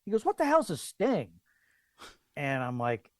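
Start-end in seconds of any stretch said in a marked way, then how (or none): 0.2-1.3 high-pitched, incredulous question; imitating a clueless policeman; baffled cop. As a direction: demand it in high bewilderment.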